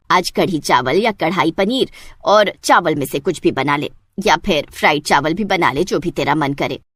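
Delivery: put-on an older village matriarch giving orders; mother-in-law. Mother-in-law voiced by narrator.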